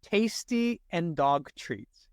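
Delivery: slow, deliberate test reading; a careful, unhurried read-aloud; read slowly. Shown as a demonstration. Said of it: "read it out loud and slowly".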